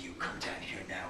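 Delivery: hushed fairy king command. Acted. Fairy king summons Brian.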